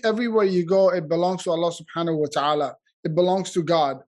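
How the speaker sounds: ordinary talk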